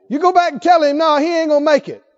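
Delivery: loud, high, quick dismissal; blunt retort. Acted. Prophet to the king's messengers, bluntly emphatic. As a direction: send them off with a forceful verdict.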